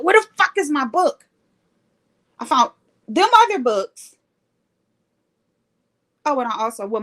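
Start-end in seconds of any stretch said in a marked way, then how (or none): 0.0-1.2 mild mock yell, pitched higher; retold frustrated yell. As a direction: act out exasperated yelling without full volume. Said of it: "I'm yelling. I'm like".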